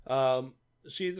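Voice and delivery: usual speaking voice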